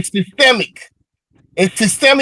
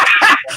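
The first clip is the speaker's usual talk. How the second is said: a quick breathy scoff of a laugh; short laugh; 7 semitones higher than usual. Real, not acted. Man laughing off his opponent's accent jab, short scoffing laugh. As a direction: laugh it off dismissively.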